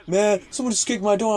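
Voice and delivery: high-pitched mock complaint; imitating aggrieved neighbor. Neighbor tells the boy about his door, imitated grievance.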